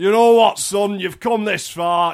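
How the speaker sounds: mock fatherly announcement to his son; dad voice. Dad telling his son it's time for Lego, hammed-up.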